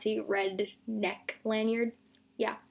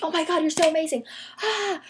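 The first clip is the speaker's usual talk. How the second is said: higher, quicker pretend fangirl outburst; mock starstruck gushing; 5 semitones higher than usual. Fan imagining what she'd tell the gymnasts, mock gushing. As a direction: gush at your idols, capped with a squeal.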